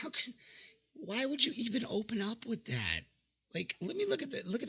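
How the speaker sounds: breathy disbelieving whisper, high; half-laughing incredulous whisper. Real, not acted. Reacting to a cringe opener.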